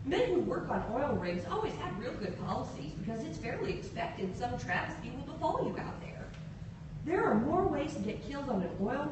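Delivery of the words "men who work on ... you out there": ordinary delivery, nothing marked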